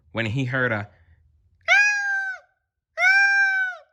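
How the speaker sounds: very high, slow meowing kitten voice; a high kitten mewing. Acted. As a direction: drag it out like a crying kitten. Narrator slips into kitten voice.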